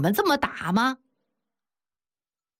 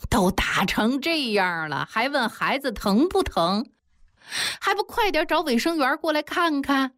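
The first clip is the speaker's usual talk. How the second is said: indignant, rising higher by the end; angry scolding; 6 semitones higher than usual. A narrator's reading. Bystander scolds grandfather over the beaten child, angry. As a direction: scold them with mounting annoyance. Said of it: "江大小就生气地说".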